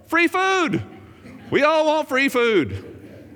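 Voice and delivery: high, slow sneering mock of the crowd. Preacher mocks crowd chasing Jesus for food, scoffing high and slow. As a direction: stretch it out, scoffing.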